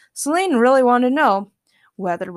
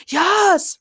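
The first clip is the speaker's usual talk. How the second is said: high, loud cry of victory; triumphant shout; 10 semitones higher, 5 dB louder than usual. A narrator's reading. Ray cheering that he leads the debate, triumphant. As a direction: whoop it out in triumph. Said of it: "Ray shouted triumphantly".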